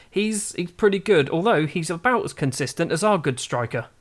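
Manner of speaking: normal talking voice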